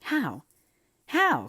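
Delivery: put-on high, eager child's outburst, rising in force; eager cry. A narrator's reading. Mary forgetting to be careful, crying out eagerly. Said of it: "cried Mary, forgetting to be careful".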